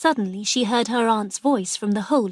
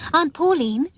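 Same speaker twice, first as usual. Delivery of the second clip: higher, calling across the yard; 7 semitones higher, 4 dB louder than usual. A narrator's reading. Sis calling to her aunt before the storm, raised call. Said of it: "she called".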